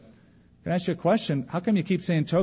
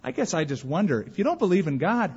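ordinary talk, nothing marked

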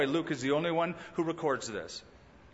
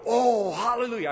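higher, louder mock hallelujah; mock joy; 6 semitones higher, 4 dB louder than usual. Preacher mocking a pious reader, higher mock joy. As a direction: fake delight at the commandments. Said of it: "I don't go".

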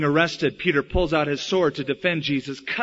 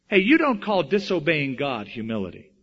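normal talking voice

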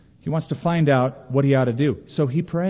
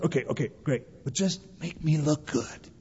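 muttered brush-off, much softer; dismissive Saul; 8 dB quieter than usual. Retold or imitated. Saul brushing off the prophet's rebuke, muttered softly. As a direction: wave it off quietly. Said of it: "he goes".

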